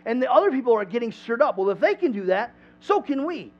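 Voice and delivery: lightly acted enthusiastic boasting; imitating excited bragging. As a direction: talk them up like an eager promoter. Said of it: "he's been telling people".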